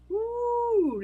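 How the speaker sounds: very high, loud excited squeal; delighted squeal. Real, not acted. Delighted at an avatar.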